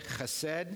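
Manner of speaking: chesed with a rasping throat ch at the start, like Scottish loch; guttural ch. Shown as a demonstration. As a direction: scrape the ch in chesed from the back of the throat. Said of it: "Gotta give that a little ch".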